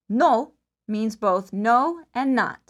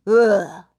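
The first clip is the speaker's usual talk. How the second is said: disgusted ugh; 4 semitones lower, 6 dB louder than usual. Shown as a demonstration. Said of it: "thumbs down and stick out your tongue".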